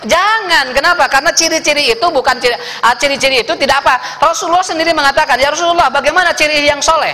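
high, fast, loud preacher's burst; fired-up preaching. Acted. Preacher arguing with his flock, fast and high. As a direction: rattle it off with rising heat.

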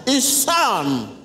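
high, very slow and drawn-out guiding voice; slow meditation guidance. Acted. Pastor guides the congregation into meditation, high and very slow. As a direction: stretch each phrase to lead the congregation inward.

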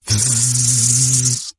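imitated syringe spray, drawn out; long buzzing spray hiss. A narrator's reading. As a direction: make a long vzzzz like a spray. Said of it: "went the spray".

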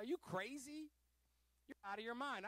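high-pitched, incredulous challenge; retold incredulous rebuke. Pastor to friend holding a grudge, high incredulous rebuke.

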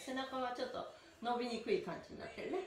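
ordinary talk, nothing marked

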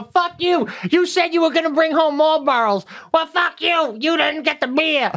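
high-pitched hammed-up screaming fight; mock yelling mom. Mom yells at grandma over Marlboros, high mock shouting. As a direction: yell it like a trashy family brawl. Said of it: "mom yells at grandma".

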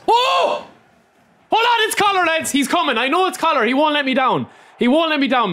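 high, rising excitement as the card reveals; sudden thrilled yelp, then eager hope; excited. Real, not acted. Streamer sees his wanted card coming in a pack, excitedly high.

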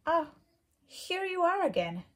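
high, kind welcoming bug voice; friendly ladybug voice. Acted. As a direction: greet her gently like a friendly bug. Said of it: "said the friendly ladybug".

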